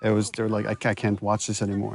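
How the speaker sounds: usual speaking voice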